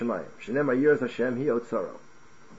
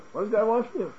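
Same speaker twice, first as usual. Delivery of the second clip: what's-the-big-deal reading; 8 semitones higher than usual. Shown as a demonstration. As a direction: read it as if it asks next to nothing. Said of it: "I read it with a certain nuance".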